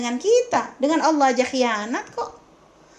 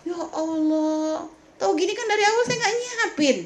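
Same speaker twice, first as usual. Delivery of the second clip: exasperated sigh; 4 semitones higher than usual. Pretend. Host fed up after guest refuses.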